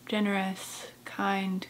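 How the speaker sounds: usual speaking voice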